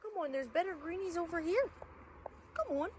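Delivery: high, quick, soft luring voice; talking to a horse. Real, not acted. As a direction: lure him over sweetly.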